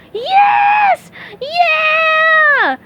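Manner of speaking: high, loud joyous scream. Acted. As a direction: scream with wild joy. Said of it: "screamed SpongeBob".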